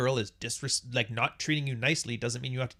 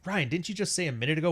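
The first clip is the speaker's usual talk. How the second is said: ordinary delivery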